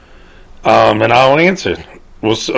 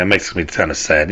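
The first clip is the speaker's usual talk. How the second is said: a touch of sadness; understated, slightly subdued sadness; mildly sad; 5 semitones lower, 5 dB quieter than usual. Real, not acted. Vlogger admitting he's out of shape, mildly sad. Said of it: "Did y'all hear the sadness in my voice?".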